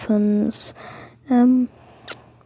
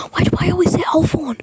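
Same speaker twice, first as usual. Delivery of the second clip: higher, quick self-scolding; fast, raised-pitch exasperation at your own slip; self-exasperated; 6 semitones higher, much faster than usual. Real, not acted. Annoyed at own repeated slip.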